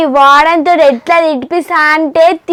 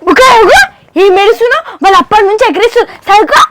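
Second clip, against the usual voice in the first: high, urgent scolding; exasperated hushing; 5 semitones higher, faster than usual. Acted. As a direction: scold the crier to stop, losing patience. Exasperated, hushing a crying friend. (విసుగ్గా గద్దింపు)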